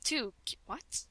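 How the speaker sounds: normal talking voice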